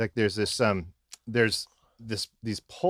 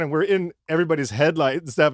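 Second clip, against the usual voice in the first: ordinary talk, nothing marked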